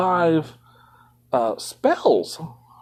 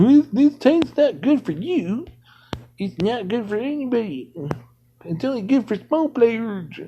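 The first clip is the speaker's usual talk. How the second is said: mocking made-up voice; 7 semitones higher than usual. Streamer mocking game liars in a silly voice. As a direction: parody the liars in a silly high voice. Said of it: "And they talk like that".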